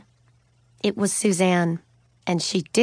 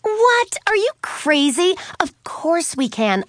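incredulous outburst; 10 semitones higher, 3 dB louder than usual. A narrator's reading. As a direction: start way up in shock, then insist. Suzanne disbelieves her friend's refusal.

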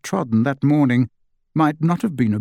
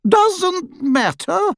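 far higher, slow disbelieving echo of his words; incredulous echo; much slower than usual. A narrator's reading. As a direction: throw his words back at him, stunned. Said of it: "repeated Hestia".

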